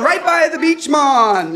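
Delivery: loud, high mock Jamaican accent; put-on Jamaican accent. Carrying on the joke with a supposed friend, hammed-up Jamaican accent. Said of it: "and I kept going on".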